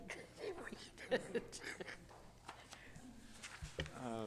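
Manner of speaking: soft real laugh, trailing on; amused laugh. Real, not acted. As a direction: laugh quietly at your own remark. Joking about needing a magnifying glass.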